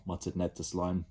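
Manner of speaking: usual speaking voice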